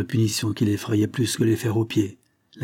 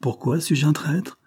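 higher, defiant retort; not lowering his voice; 6 semitones higher than usual. Acted. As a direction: fire the question back without backing down. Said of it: "sans baisser le ton".

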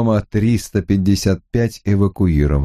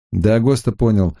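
usual speaking voice

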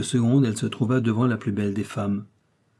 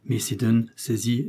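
ordinary delivery, nothing marked

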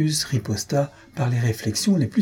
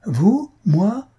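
much higher, louder outraged disbelief; indignant surprise; 10 semitones higher, 4 dB louder than usual. A narrator's reading. The maid rejects the scholar's claim in outraged surprise. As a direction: shoot up in shocked denial.

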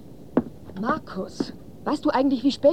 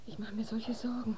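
whisper; 10 dB quieter than usual. Acted. Worried mother at night.

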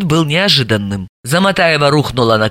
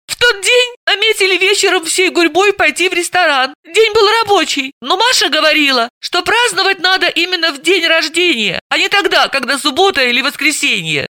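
high-pitched, tearful woman telling her story; hysterical sister; 13 semitones higher than usual. Acted. Suspect confessing to detective, high tearful telling. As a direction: recount it in a high fragile voice.

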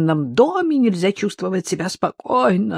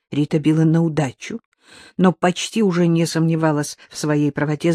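plain reading voice

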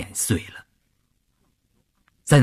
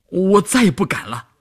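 frightened plea; 5 semitones higher than usual. A narrator's reading. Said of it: "双腿发软地说".

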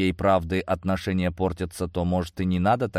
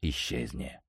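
low menacing; 6 semitones lower, 7 dB quieter than usual. Acted. Drunk man dismissing colonel.

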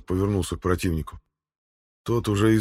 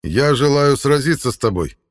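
proud; 5 semitones higher, 6 dB louder than usual. A narrator's reading. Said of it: "гордо ответил Хаук".

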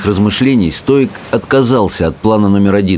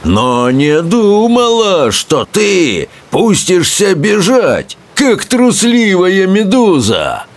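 high, slow, mocking roar; roaring Bertha; 10 semitones higher, slower than usual. A narrator's reading. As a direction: jeer at him like a warrior queen. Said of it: "проревела большегрудая Берта".

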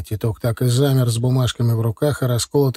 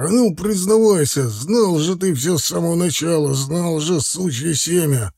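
raised-pitch, pressing demand; angry accusation; 5 semitones higher than usual. A narrator's reading. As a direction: press hard for a confession. Zakhar demands a confession.

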